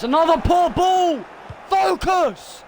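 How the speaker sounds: high, loud scolding, building to a yell; angry fan's outburst; exasperated shout. Real, not acted. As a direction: yell at the team for the mistake. Berating team for bad pass.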